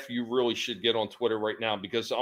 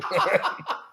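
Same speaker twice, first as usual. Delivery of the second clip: squeaky laugh. Acted. Guinea pig joke laugh.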